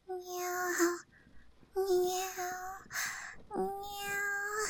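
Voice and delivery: high, very soft meows; cat meow. Acted. Cat girl being petted by her master, soft high meows. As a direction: mew slowly and softly, like a petted cat.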